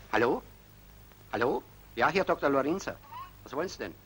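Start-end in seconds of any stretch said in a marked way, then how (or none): none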